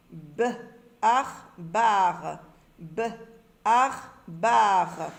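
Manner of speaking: B, pause, "ar", then a loud long "bar"; sounding out syllables. Shown as a demonstration. Teacher drilling syllables for readers, bar sounded out piece by piece. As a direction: blend B and ar firmly into bar. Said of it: "Forme et lit des syllabes".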